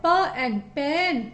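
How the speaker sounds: म and man stretched very slowly; slow phonic blending. Shown as a demonstration. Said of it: "पी का phonic sound प".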